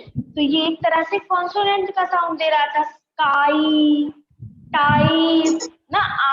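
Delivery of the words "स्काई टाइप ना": स्काई stretched with a long आई sound; slow demonstration. Shown as a demonstration. Teacher stretches स्काई to show the vowel. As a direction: draw out स्काई slowly. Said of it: "आई का sound आ रहा था".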